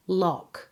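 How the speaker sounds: Lock said exactly like l-o-c-k; lough as lock. Shown as a demonstration. Teacher modelling lough for learners, pronounced Lock. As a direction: say Lock with a hard final k. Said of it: "pronounced exactly the same way as L O C".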